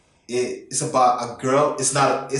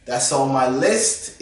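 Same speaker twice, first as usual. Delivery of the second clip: put-on Norwegian accent; 5 semitones higher, slower than usual. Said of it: "I have to put my Norwegian accent".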